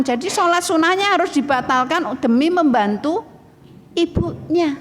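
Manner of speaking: high-pitched imitation of an upset child; retold tearful child. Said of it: "Sampai anak saya nangis". (meniru anak yang menyesal sampai menangis)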